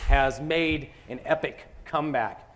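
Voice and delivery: ordinary talk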